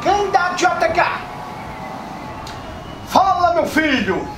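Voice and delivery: folksy candidate voice. Acted.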